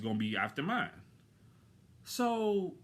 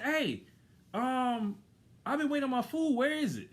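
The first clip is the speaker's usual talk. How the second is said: retold yelling; 9 semitones higher than usual. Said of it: "I actually start yelling".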